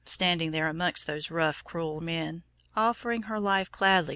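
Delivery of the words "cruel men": usual speaking voice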